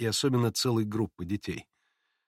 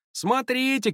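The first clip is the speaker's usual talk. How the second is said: high-pitched drawn cry; warning call; 13 semitones higher, slower than usual. A narrator's reading. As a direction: call a warning after him. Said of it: "кричали ему вслед предупредительно". (предостерегающий окрик)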